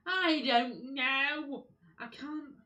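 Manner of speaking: high, loud, dragged-out yell of frustration; frustrated shout. Real, not acted. Gamer yelling at the game, lost and furious. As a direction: howl it out at the game, totally lost.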